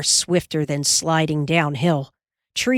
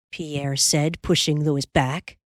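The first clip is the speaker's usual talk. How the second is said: plain reading voice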